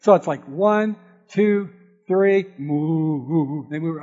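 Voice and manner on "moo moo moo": low, drawn-out imitation moo; mock cow moo. Boy counting cattle on the hill, mooing like the cows.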